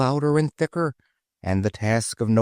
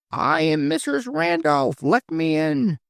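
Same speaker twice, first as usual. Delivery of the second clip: much higher, calling to be let in; high pleading voice. Acted. Voice outside the gate begging to be let in, high. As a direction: call through the gate in a high voice.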